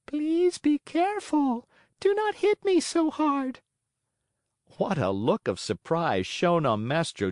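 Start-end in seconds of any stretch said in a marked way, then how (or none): 0.1-3.6 beseeching wee voice. A narrator's reading. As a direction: beg like a tiny wooden voice. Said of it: "a wee little voice say in a beseeching tone".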